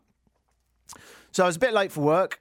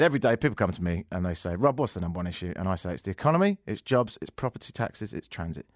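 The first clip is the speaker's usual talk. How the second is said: mimicking Rob Ford; 7 semitones lower, 3 dB quieter than usual. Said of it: "But anyway, he said".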